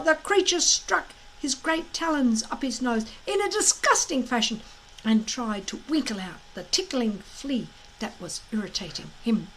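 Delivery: ordinary delivery, nothing marked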